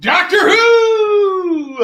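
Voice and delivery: loud, high, slow drawn-out call of "Doctor Who"; excited shout. Real, not acted. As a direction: belt the title out like a barker. Calling out comic titles.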